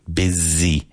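"Busy" rhymes with dizzy, not fussy; Busy said as bizzy. Shown as a demonstration. Teacher to students: "Busy" said as bizzy. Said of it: "even though it's spelled B U S Y".

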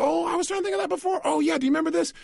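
mock excited remembering; sudden remembering. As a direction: burst out as if it just came back. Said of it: "You go".

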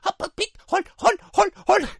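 very high squeaky honking cries; clown honks. Pretend. Clown faithful to his trade, high squeaky honks. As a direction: honk like a toy clown horn. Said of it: "Era fiel a su oficio de payaso".

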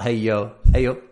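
Yorkshire "Hey, yo" said as 'ey up'; Yorkshire greeting. Shown as a demonstration. Teacher correcting a learner's Yorkshire greeting, Yorkshire pronunciation. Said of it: "it's not "Hey, yo".

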